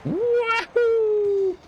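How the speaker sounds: high joyful wahoo shout; wahoo yell. Real, not acted. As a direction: cheer it out at full voice. Yelling after a sweet trick.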